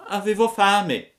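put-on high, puppet-boy voice, pleading; a wooden boy begging for help, pitched high; high child voice begging the firefly; Pinocchio pleading. A narrator's reading. Pinocchio answers.

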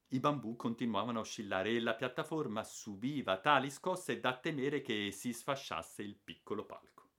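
usual speaking voice

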